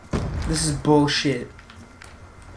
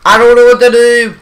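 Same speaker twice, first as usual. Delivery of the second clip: high, loud panicked gamer yell; frustrated yelling; 8 semitones higher, 8 dB louder than usual. Real, not acted. Gamer swarmed by too many players, yelling in loud panic. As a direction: shout it as if swarmed by enemies. Overwhelmed in a game fight.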